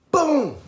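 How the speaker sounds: loud high shouted boom; explosive shouted impact; punch sound. Retold or imitated. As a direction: yell it as the blow lands. Imitates a punch landing.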